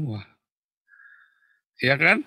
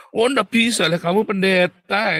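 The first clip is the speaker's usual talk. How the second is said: high, loud objection; stern counsellor; 8 semitones higher, 5 dB louder than usual. Acted. Counsellor to a pastor, forceful rebuke.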